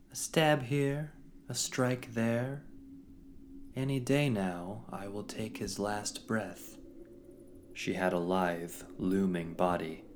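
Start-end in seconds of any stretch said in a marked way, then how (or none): 0.1-6.8 high slow silky menace; silken villainess. Acted. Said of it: "came a silken voice".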